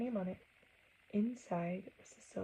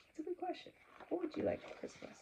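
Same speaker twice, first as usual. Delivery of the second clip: hushed; 10 semitones higher, 8 dB quieter, faster than usual. Acted. Echoing Santa's soft question.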